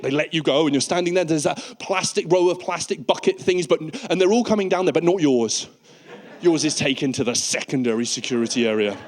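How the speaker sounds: normal talking voice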